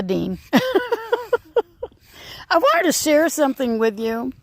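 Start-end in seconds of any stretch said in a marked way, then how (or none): 0.4-1.9 high laugh. Real, not acted. Laughing after the joke.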